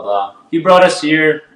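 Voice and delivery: ordinary delivery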